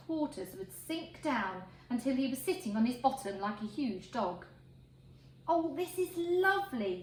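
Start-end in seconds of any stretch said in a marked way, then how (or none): 5.5-7.0 blissful sigh of a happy pig; a pig thinking how lovely this is, dreamy delight; pig's contented thought. Acted. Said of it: "you could hear him thinking".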